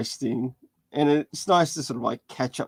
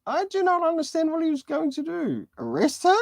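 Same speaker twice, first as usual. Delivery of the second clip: much higher, quick, earnest puzzlement; high innocent child voice; innocent kid; 13 semitones higher, faster than usual. Acted. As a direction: play it as a naive, literal child. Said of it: "innocent Damien saying".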